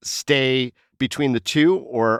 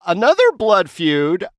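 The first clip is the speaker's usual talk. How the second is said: mock dramatic; 8 semitones higher, 7 dB louder than usual. Dramatic news-style intro.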